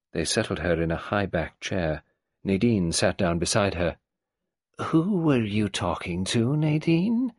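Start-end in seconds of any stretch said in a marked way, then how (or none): 4.8-7.3 put-on higher, creaky old matriarch voice; old tyrant mother. Old Mrs Boynton greeting Nadine, in her higher old-woman voice. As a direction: play the domineering old woman.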